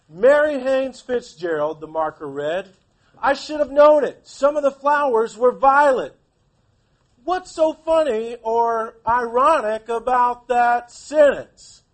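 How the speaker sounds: loud, slow dramatic read-aloud; storybook reading, loud and theatrical; reading aloud to class. Acted. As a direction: read the passage out with big expression to the kids. Teacher reads novel aloud.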